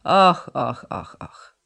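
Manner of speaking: whispered mock groaning och och; a breathy theatrical lament from an old man. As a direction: sigh it out in a hushed mock lament. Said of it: "ręce załamał".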